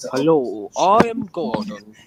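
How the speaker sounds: a light take on a proud engine; lightly voiced slow Gordon; Gordon impression. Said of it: "This is my Gordon impression".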